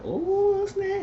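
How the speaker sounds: very high, squealing ooh snap; high-pitched gossip squeal; mock squeal. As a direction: squeal it like a giddy girl. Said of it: "And she said".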